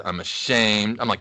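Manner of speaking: ordinary talk, nothing marked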